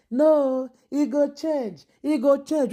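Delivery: high, mock-hopeful imitation of a naive girlfriend; lightly voiced woman insisting he will change. Mocking a woman's naive hope.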